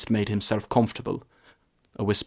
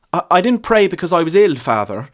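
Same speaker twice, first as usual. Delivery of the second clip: high, louder stammering interruption; nervous lad; 8 semitones higher, 7 dB louder than usual. A narrator's reading. Lad interrupting the priest to confess. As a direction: blurt it out, cutting him off. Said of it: "the youth interrupted".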